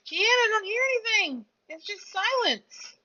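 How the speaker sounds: high-pitched playful whine; whiny complaint. Real, not acted. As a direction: whine it up high to an absent friend. Playful complaint to Kian about silence.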